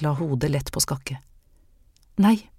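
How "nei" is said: a firm, louder no. A narrator's reading. Anne firmly denies to Miss Øyseth, higher and louder. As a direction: cut it off decisively. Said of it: "sa Anne bestemt".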